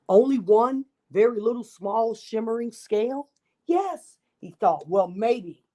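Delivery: put-on lively read-aloud for children; storybook narration. A narrator's reading. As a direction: tell it like a picture book to a class. Reads picture book to children.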